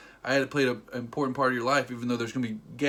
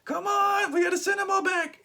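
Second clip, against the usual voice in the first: high, loud shouted character voice; movie hero shouting; 4 dB louder than usual. Retold or imitated. Recap host voices a character rallying Jake, high shout. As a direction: call out urgently at a high pitch.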